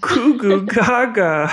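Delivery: put-on high, slow, giggly baby babble; baby talk. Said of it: "what's all be babies this episode".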